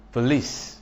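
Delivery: "police" with a weak first syllable; stress on second syllable. Shown as a demonstration. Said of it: "does not take stress".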